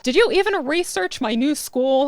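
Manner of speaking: put-on indignant teenage scoff. A narrator's reading. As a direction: ask it in scornful disbelief. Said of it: "I scoff".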